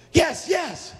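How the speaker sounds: high-pitched excited yes; acted excitement; eager girls. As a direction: jump on the answer eagerly. Girls eagerly answer the man.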